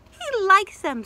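pleased Mama. Acted. Mama watching Wolfie eat, high and pleased. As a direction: say it like a proud mom.

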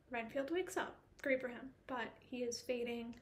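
usual speaking voice

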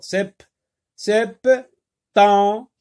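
"septembre" broken as sep... tem... bre; high, loud "septembre" with long gaps; syllable by syllable. Shown as a demonstration. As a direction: sound out each syllable of "septembre". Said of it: "Le son en se trouve dans la deuxième syllabe".

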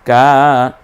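drawn-out কা; long a. Shown as a demonstration. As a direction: stretch the a of কা. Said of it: "এক আলিফ টেনে বলবো".

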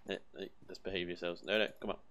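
ordinary talk, nothing marked

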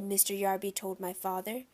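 usual speaking voice

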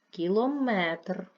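slow, loud Кілометр, stretched on -метр; stress on third syllable. Shown as a demonstration. Teacher sorting words by stress, third-syllable stress on Кілометр. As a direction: draw out the last syllable of Кілометр. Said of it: "Третій".

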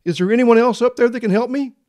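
high, loud call upward; man hanging on. Acted. Hanging man seeking someone else up there, high and loud. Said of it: "he looked up and he said".

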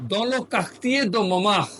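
Dans le quartier de Montmartre spelled out very slowly, word by word; slow French teacher demo; slow model reading. Shown as a demonstration. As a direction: read it very slowly for the learner to copy. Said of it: "তাহলে কি বললো?".

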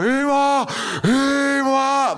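high, loud, drawn-out call for mom; imagined heroic shout. Retold or imitated. Kid in the pit imagining a brave call for mom, loud drawn shout. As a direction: bellow for mom as you picture it. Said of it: "דמיינתי את זה ככה".

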